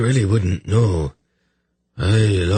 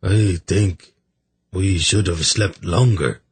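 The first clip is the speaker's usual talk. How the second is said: mournful. A narrator's reading. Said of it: "replied with a mournful look".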